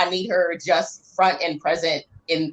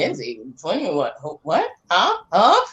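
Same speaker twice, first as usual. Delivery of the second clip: clueless people. Acted. Imitating people who don't know the dancer, clueless. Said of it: "people just go".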